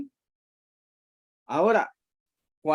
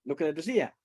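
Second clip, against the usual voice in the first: normal talking voice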